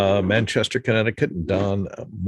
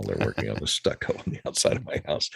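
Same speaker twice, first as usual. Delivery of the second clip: scratching in background; 10 semitones lower, 6 dB quieter, faster than usual. Real, not acted. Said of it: "sorry for the scratching noises".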